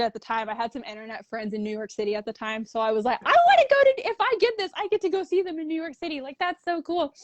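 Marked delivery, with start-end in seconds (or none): 3.2-7.1 excited gush. Retold or imitated. Retold excited thoughts about trip.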